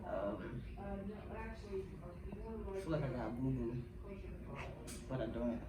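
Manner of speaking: hushed. Real, not acted. Nervous before surgery, hushed.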